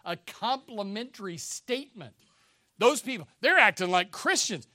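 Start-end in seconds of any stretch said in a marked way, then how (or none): 2.8-4.7 a touch of scorn, louder on they're; mocking onlookers. Pastor imitating early critics, mild mocking jeer. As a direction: lightly sneer at the believers. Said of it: "it was kind of mocking them".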